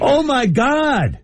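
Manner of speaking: high, very slow, drawn-out gasp of disbelief; stunned. Real, not acted. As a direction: stretch each word in shock. Reacting to the price.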